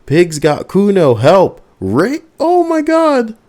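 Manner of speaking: put-on high, loud panicked kid's yell for help; child shouting for help. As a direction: scream it up at the windows like a kid. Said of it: "the child shouts at the windows".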